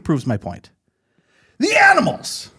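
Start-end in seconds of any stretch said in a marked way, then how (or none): 1.6-2.5 mock outrage. Mock indignant argument.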